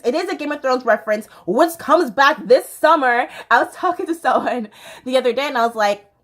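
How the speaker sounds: ordinary delivery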